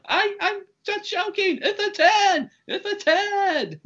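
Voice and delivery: imitated high-pitched frantic pleading; panicked yelling. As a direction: yell it very high, as if begging off an attacker. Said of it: "We just hear him yelling".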